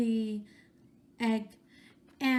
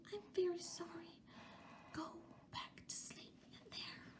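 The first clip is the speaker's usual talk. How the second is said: gentle apologetic whisper; soft whisper; 5 semitones higher, 16 dB quieter than usual. A narrator's reading. Goose apologizing to the egg, soft whisper. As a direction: breathe it softly to the egg. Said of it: "and whispered softly".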